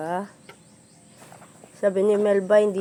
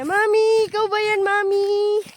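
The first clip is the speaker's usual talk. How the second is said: put-on much higher and louder, a whining baby calf calling Mommy; high crying calf voice; 11 semitones higher, 7 dB louder than usual. Owner voicing her calf calling to her, crying high and loud. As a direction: wail it like a little calf crying for its mother. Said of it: "Sabi niya".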